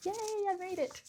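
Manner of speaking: put-on high, squealing little girl yay; girlish cheer. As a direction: squeal like a kid who finally got the present. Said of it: "my internal eighties girl is just going".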